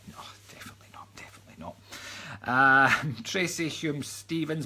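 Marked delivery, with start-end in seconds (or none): 0.1-1.8 fast guilty whisper. Pretend. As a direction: hiss the denial under your breath. Whispered denial about swearing.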